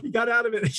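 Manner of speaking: a lightly mocked crowd cheer. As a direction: play the cheering audience. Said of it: "they're like".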